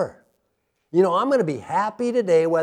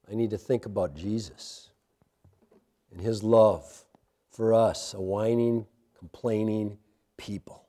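prayer; 9 semitones lower than usual. Acted. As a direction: pray humbly over the room. Closing prayer.

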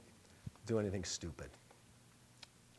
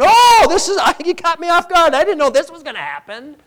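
much higher, loud mock-flustered God; 14 semitones higher, 18 dB louder than usual. Pastor mocking the idea of a surprised God, flustered. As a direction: ham up a God caught off guard. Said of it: "He's not sitting up in heaven going".

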